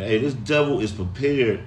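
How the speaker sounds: ordinary delivery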